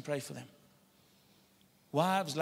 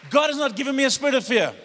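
1 loud declaration; 8 semitones higher, 8 dB louder than usual. Shown as a demonstration. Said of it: "Let's do this".

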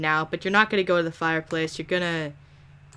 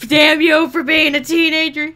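mock curse; 7 semitones higher, 6 dB louder than usual. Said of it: "I'm like".

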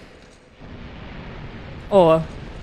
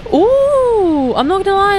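delighted awe; 12 semitones higher, 5 dB louder, slower than usual. Real, not acted. Sees a cool mask in game.

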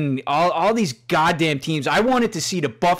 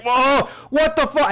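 much higher, slow, stunned outcry; shocked customer; 11 semitones higher than usual. Retold or imitated. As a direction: react to a burger full of pickles. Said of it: "the customer's like".

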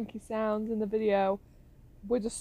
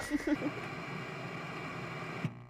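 soft unvoiced laugh out of the nose; breathy laugh; 5 semitones higher, 8 dB quieter than usual. Real, not acted. Player laughing at her own panic, a soft breathy laugh. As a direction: laugh under the breath at yourself.

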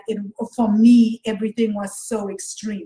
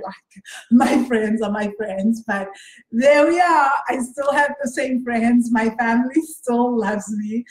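usual speaking voice

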